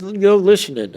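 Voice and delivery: high, loud retort; indignant protest. Retold or imitated. Inmate answers agents who tapped the calls, indignant. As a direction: throw it back at them, high and loud.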